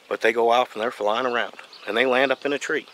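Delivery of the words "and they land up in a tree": ordinary talk, nothing marked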